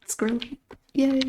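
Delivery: normal talking voice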